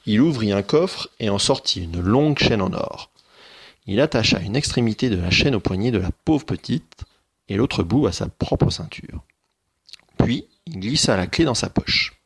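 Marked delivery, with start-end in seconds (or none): none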